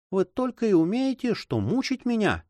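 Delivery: high, sharp cry at the nurse; angry exclamation. A narrator's reading. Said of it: "сердито воскликнула девушка".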